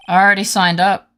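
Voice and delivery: lightly voiced surfer dude, loud; a touch of surfer, voice pushed up in volume. Surfer client answers the lawyers, lightly voiced dude. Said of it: "Oh, no, he's a surfer".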